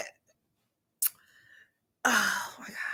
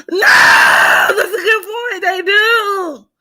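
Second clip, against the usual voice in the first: high, loud, slow exclamation; excited; 8 semitones higher, 6 dB louder, slower than usual. Real, not acted. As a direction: shout it in sudden agreement.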